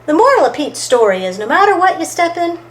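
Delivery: plain reading voice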